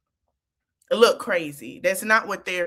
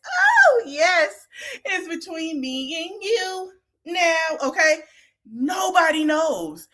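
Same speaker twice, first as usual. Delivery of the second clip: mock relief; 6 semitones higher, slower than usual. Acting a relieved therapy client.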